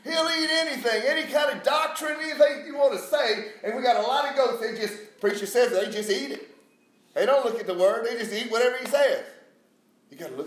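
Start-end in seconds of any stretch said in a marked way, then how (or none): 0.0-9.2 loud, high preacher's delivery; full-force sermon, voice raised; fired-up preaching. Real, not acted. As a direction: hammer it home to the congregation.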